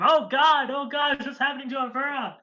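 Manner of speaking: high, loud, fast panic; panicked yell. Retold or imitated. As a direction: shout in alarm through the orb. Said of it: "yells out to him".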